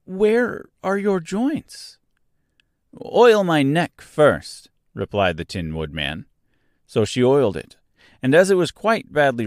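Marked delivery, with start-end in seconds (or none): 0.1-2.0 high, slow, worried question; anxious. A narrator's reading. Dorothy with the oil can, anxious to help. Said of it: "asked anxiously". 3.0-4.7 slow, louder plea from a stiff neck; Tin Man urging. A narrator's reading. Rusted Tin Man to Dorothy, slow and pleading.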